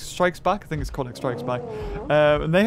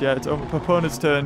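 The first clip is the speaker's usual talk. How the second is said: ordinary talk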